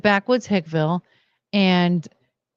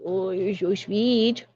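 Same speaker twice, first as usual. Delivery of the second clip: tender to dog; 4 dB quieter, slower than usual. Real, not acted.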